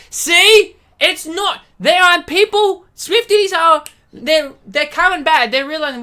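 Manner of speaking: high and loud triumphant; worked-up rant; excited outburst. Real, not acted. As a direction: blurt it out, vindicated. Excited rant about fans.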